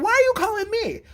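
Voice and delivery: high-pitched indignant protest. Retold or imitated. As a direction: imitate an exasperated reply on the phone. Retelling indignant phone reply.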